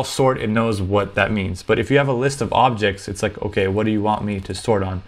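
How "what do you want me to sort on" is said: normal talking voice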